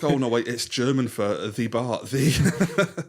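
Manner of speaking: mock German excuse, cracking up; laughing Sideshow Bob quote. Podcaster quoting Bob's excuse in court, laughing. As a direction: blurt the excuse while laughing. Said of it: "It's like".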